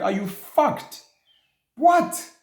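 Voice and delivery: slow disbelief then a sharp high "What?"; retorting in outraged disbelief; incredulous. Retold or imitated. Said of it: "I'm like".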